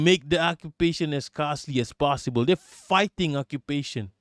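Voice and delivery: usual speaking voice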